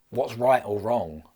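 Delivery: ordinary talk, nothing marked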